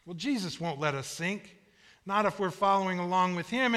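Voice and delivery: ordinary talk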